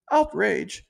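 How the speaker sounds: squeaky high mock cry of outrage. Mocking the critics with a high fake outrage. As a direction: pitch it way up like a fake gasp.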